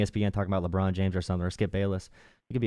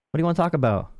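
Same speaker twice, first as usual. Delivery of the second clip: usual speaking voice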